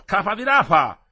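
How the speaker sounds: high, loud mocking yell; loud insult. Farmhand calling Metello a blockhead, loud and high. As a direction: bark the insult at him.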